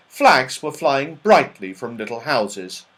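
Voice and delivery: usual speaking voice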